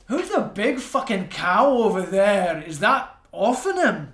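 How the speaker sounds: slow, loud, crude outburst; loud rude remark. Acted. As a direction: bellow the insult across the room. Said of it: "Phil goes".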